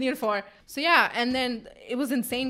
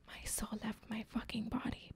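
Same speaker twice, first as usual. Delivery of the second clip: whispered dramatic aside; hushed, voiceless shock; stunned whisper; 20 dB quieter than usual. Real, not acted. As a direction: whisper it, as if the life drained out.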